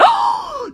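a sudden high-pitched gasp of awe; sharp high gasp. Pretend. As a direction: gasp like you've just spotted your hero. Thrilled to meet idol.

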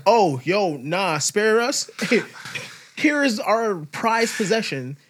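slow, high mock begging; pleading crew voice. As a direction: beg like a scared crew.

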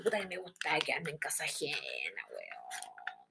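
susurro que se apaga; confidencia susurrada. Real, not acted. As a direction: bajar la voz hasta susurrar. Confides about staying at others' houses. (confesión en voz muy baja, susurrada)

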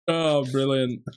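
ordinary talk, nothing marked

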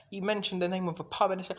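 ordinary talk

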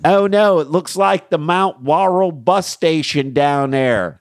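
high, loud hammed-up Philly come-on; Philly accent. Host riffing on Philly Zoom porn, in a hammed-up Philly accent. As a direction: lay on a thick Philly accent.

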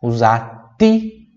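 "usati" with a pure, crisp t; slowly: "usati", clean Italian t. Shown as a demonstration. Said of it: "pronúncia por do ti".

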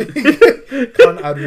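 slow laughing, very loud; drawn-out laughing. Pretend. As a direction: stretch the name while laughing.

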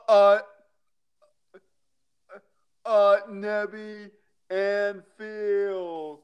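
loud, drawn-out hype announcing; announcer intro. Acted. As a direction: stretch it like an arena announcer.

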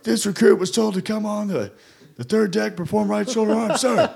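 high, dazed recruit reporting in his sleep; Rainey sleep-talks the drill report, high and dazed; sleep-talking recruit. Retold or imitated. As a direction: parrot a recruit's report while fast asleep. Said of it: "he's like".

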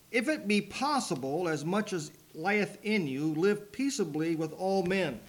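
slow, lifted King James verse reading; scripture reading. Acted. Teacher reads Romans aloud to the class. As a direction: read the verse with solemn weight.

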